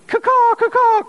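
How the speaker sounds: high squawking caw-caw; hammed-up crow call. Imitating crows.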